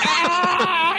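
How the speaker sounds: very high, long mock shriek; hammed-up high wail; imitated scream. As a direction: scream like a woman giving birth, played for laughs. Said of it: "Screaming her fucking full head off".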